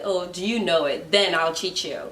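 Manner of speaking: ordinary talk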